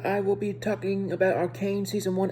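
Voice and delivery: ordinary talk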